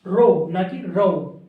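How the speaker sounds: रो said slowly with a plain o, then contrasted; रो slowly, ro not rau; Indian pronunciation of row. Shown as a demonstration. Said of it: "Indian accent में इसको क्या pronounce करते हैं".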